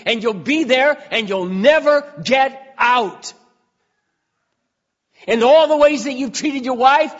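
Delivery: loud, high hellfire warning; fiery warning. Retold or imitated. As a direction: thunder the warning straight at him. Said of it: "and I said".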